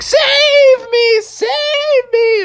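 very high, loud bleating cry; drawn-out high goat wail; billy goat plea. Acted. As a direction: squeal for rescue like a little goat. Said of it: "And Billy was like".

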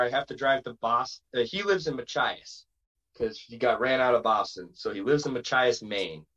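ordinary talk

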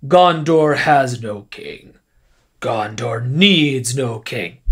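slow, lowering mock-solemn pronouncement; a hint of pompous Boromir, drawn out. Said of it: "that really, really stupid phrase".

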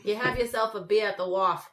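hammed-up Boston accent, r's dropped; mock Boston townie accent; horrible Boston accent. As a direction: play a bad Bostonian. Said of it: "It's a horrible Boston accent".

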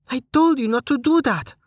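high choked-up scold; tearful scolding Amelia. A narrator's reading. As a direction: scold him while near tears. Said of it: "she cried, a hitch in her voice".

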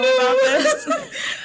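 overcome, high and loud through laughter; getting emotional. Real, not acted. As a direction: voice leaping up with real emotion. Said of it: "I'm getting so emotional".

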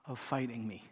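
slow, soft and tender, asking not accusing; gentle voice of Jesus. Pretend. Jesus gently asks a defiant listener to stop fighting, slow and tender. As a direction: ease the fight out of them slowly. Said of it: "and he says".

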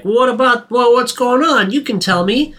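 high, coaxing cop voice; a cop gently coaxing her to talk. Retold or imitated. Cop coaxing Audrey to open up, high and coaxing. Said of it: "he's like".

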